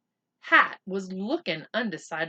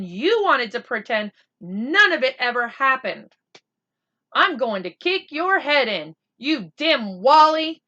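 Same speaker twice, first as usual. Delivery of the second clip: furious telling-off; 4 semitones higher, 9 dB louder than usual. Acted. Boy threatens friend in fury.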